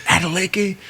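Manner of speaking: calling the name out high and amazed; a sudden, very high, astonished call of the name; surprised call. Retold or imitated. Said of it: "and they said".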